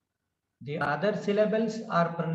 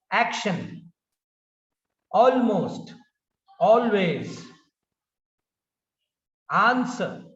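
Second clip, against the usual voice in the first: each word from "action" to "Business" punched on its first syllable; word-list drill, strong opening syllable and falling end; stress on first syllable; 4 dB louder than usual. Shown as a demonstration. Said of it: "Stress on the first syllable".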